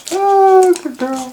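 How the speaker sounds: high, bright praise for the dog; pet talk voice. Real, not acted. Praising dog after bath.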